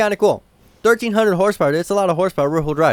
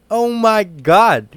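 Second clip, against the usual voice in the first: laughing; 4 semitones higher, 5 dB louder, much slower than usual. Real, not acted. Amazed laugh at the car.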